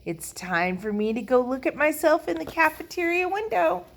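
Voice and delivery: high, lilting self-satisfaction; vain girl. A narrator's reading. Lucille leaves to admire her reflection, airily vain. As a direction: announce it with airy vanity.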